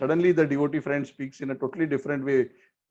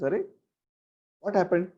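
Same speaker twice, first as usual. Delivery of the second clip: surprise; 3 semitones higher, faster than usual. Retold or imitated. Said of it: "he's expressing surprise".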